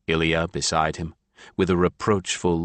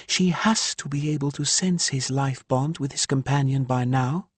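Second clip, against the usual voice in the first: higher woman's voice, aggrieved; Ilya's voice; 9 semitones higher than usual. A narrator's reading. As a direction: voice the exasperated mother Ilya. Narrator voices Ilya.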